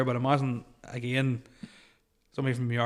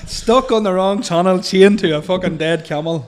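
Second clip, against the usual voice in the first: laughing lyric recital; 4 semitones higher, 8 dB louder than usual. Acted.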